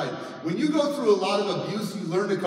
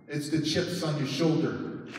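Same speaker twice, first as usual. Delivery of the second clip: ordinary talk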